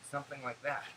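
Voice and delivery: ordinary delivery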